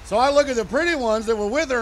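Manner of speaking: ordinary talk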